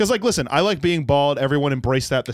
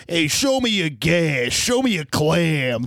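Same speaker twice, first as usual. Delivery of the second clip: slow greasy New York club owner; sleazy slow New York accent; gross New York accent; 3 semitones higher, much slower than usual. Retold or imitated. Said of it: "saying it with that gross voice".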